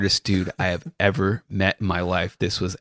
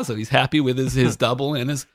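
ordinary talk, nothing marked

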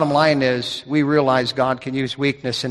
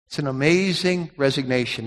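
ordinary talk

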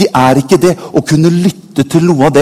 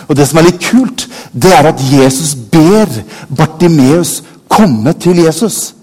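usual speaking voice

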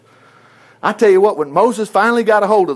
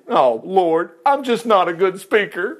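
hammed-up reluctant begging; pleading Moses; slower than usual. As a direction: plead helplessly, ending on a drawn-out moan. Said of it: "but Moses pleaded".

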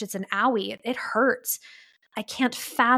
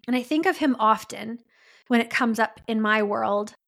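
ordinary talk

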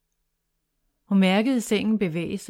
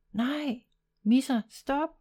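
higher, urgent call to stop the cat; shouting; 6 semitones higher, slower than usual. Acted. Sara calls her cat to stop, shouting. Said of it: "råbte Sara".

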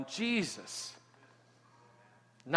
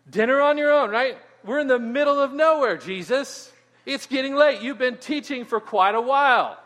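high, loud, pushy complaint to the teacher; disciples complaining; 8 semitones higher, 6 dB louder than usual. Retold or imitated. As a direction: urge Jesus it is late, loudly. Disciples pleading with Jesus.

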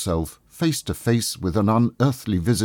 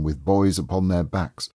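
ordinary delivery

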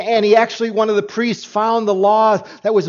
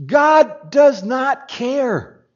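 each word slowly laid down; slow emphatic statement; 5 semitones higher, much slower than usual. Shown as a demonstration. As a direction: spell it out slowly. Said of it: "I think it's this".

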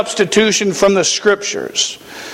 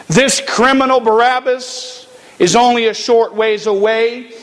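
ordinary delivery

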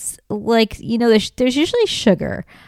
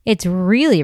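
ordinary talk, nothing marked

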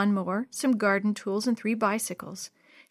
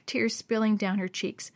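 ordinary delivery

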